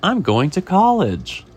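high, slow, loud announcement; proud fish. Acted. Fish telling the chef its plans, acted proud declaration.

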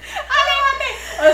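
high, drawn-out cry of disgust; a stretched-out, squealing "aléjate"; mock horrified shriek. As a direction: shriek it like you want to run away. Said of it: "Es como un cringe".

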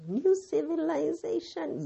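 high, slow incredulous echo; surprised question. Pretend. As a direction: ask it as a doubting listener. Echoing listener's doubtful question.

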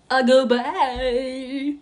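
slow, loud, wailing goodbye; mock tearful goodbye. Host hamming a teary farewell to listeners, slow and loud. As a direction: drag the goodbye out like fake crying.

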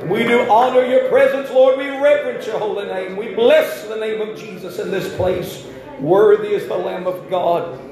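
high, loud worship prayer. Acted. Pastor leading the church in worship, loud and high. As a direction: lift the room into praise. Leads worship over music.

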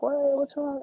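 high, loud imitation of worried people asking; mock concerned friends. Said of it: "people are like".

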